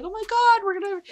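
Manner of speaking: put-on high, eager kid voice; little sister bursting with good news, pitched high; excited little girl. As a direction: squeal it like a thrilled kid sister.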